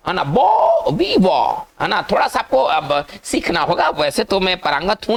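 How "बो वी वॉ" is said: बो held high, then वी वॉ; बो वी वॉ slowly split into open syllables; French pronunciation demo. Shown as a demonstration. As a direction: stretch बो, then say "vee-waw". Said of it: "इसको क्या पढ़ेंगे".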